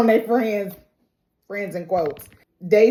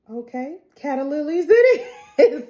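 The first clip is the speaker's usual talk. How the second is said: higher, louder laughing resignation; 6 semitones higher, 4 dB louder than usual. Real, not acted. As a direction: give in while laughing. [name] giving in, laughing.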